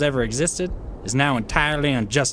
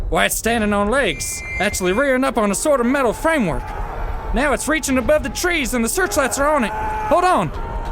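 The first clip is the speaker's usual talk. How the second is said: climbing higher, faster and tenser; rising alarm; 9 semitones higher than usual. Acted. Captain watching the machine rear up, growing alarmed. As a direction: voice ramping up into alarm as it rises.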